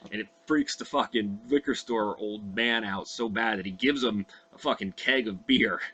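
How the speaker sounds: normal talking voice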